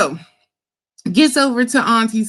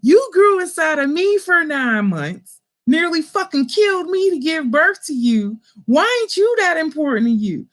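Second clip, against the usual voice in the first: emphatic mother; 8 semitones higher than usual. Retold or imitated. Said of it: "So I said".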